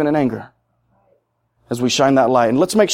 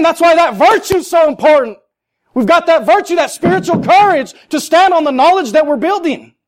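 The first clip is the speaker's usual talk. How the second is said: high, insistent challenge to the congregation; full-force preaching; 10 semitones higher than usual. Real, not acted. Preacher challenges listeners on where they will turn, fervently. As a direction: press each question home in a raised voice.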